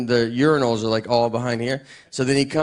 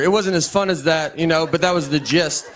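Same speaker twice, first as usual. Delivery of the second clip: ordinary talk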